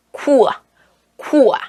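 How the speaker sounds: "쿠아" opened out as "koo-ah". Shown as a demonstration. Teacher models the correct QU sound, "쿠아" as "koo-ah". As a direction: say "쿠아" with a distinct "koo" gliding to "ah". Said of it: "발음으로 해주셔야 돼요".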